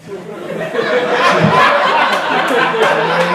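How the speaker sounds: loud laugh at his own punchline; long hearty laugh; big laugh. Real, not acted. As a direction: crack up.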